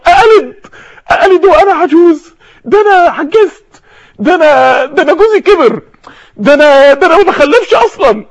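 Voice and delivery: high, emotional disbelief; overwhelmed old woman's incredulous cry; astonished protest. Acted. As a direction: protest in amazement, pitch held high. Acting old Sarah's astonished protest.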